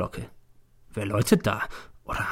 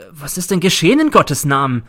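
raised, louder, pleading question; exasperated plea; 5 semitones higher, 5 dB louder than usual. Acted. Teacher pleads with silent police.

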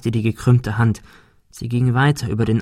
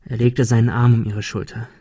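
usual speaking voice

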